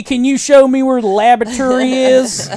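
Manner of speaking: high, slow, overdone tourist voice; hammed-up American tourist. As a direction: ham up the clueless American abroad. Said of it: "ham up being American".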